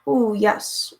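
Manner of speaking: usual speaking voice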